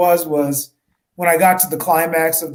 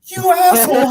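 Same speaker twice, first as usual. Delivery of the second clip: higher, louder mock insult; mock outburst; 12 semitones higher, 5 dB louder than usual. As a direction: blurt the insult in a raised mock voice. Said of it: "I was like".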